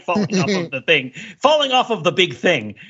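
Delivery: ordinary talk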